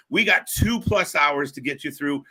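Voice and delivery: usual speaking voice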